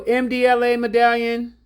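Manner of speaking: ordinary delivery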